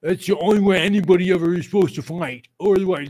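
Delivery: a touch of whiny mock fan. Mocking fans who want only stand-up fights, lightly whiny. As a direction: parody their smug complaint, a bit high. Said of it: "Not this".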